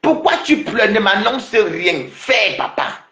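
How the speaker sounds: high, stretched-out goading; high-pitched, slow taunt; taunting challenge. Real, not acted. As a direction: draw it out high at the crybaby. Taunts the crying opponent.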